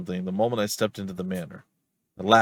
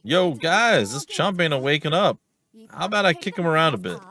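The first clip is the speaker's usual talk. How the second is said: higher-pitched, rough-and-ready rowdy character; rowdy detective; 7 semitones higher, 4 dB louder than usual. Acted. Rowdy detective urging friends to kick an unconscious intruder, rowdy and high. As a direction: pipe up as an impatient troublemaker, pitched high.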